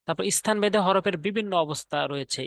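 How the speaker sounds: ordinary talk, nothing marked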